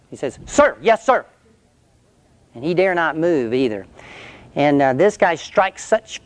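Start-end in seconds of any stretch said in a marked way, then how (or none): 0.5-1.2 recruit's reply. Retold or imitated. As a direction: fire it back like a scared recruit. Recruit answers the drill sergeant.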